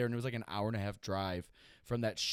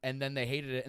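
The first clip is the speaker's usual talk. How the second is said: ordinary talk, nothing marked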